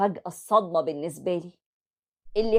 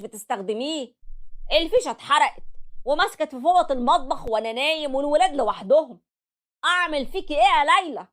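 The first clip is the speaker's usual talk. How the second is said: angry berating; 6 semitones higher than usual. Acted. Husband berating his wife after the kitchen fire, angry. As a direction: tear into her for the near-disaster.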